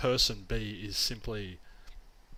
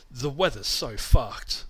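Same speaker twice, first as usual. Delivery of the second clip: mock complaint; 7 dB louder, slower than usual. Mocking a complaint about weather.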